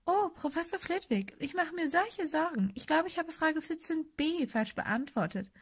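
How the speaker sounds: put-on high, soft, simpering girl's voice; mock-anxious falsetto of a swot; mocking Hermine. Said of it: "Er ahmte Hermines Stimme nach".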